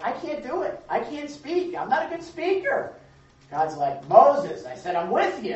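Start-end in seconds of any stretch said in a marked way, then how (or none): 0.0-2.9 high, whiny pleading; reluctant Moses. Retold or imitated. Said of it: "Moses is like". 4.1-5.6 loud, firm rebuke; God putting Moses in his place; God scolding Moses, louder; angry God. Retold or imitated. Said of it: "he says he's angry".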